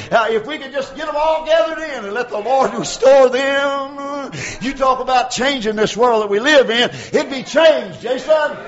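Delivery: loud, high preaching shout; full-force preaching. Acted. Preacher proclaiming the word of God, shouting. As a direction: proclaim it like thunder from the pulpit. Preaching about gathering sheep.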